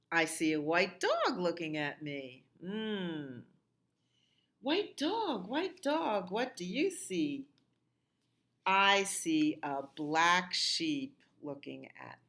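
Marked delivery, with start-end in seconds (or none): 4.6-7.5 much higher sing-song chant of the refrain. Acted. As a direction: lilt it up high for the children.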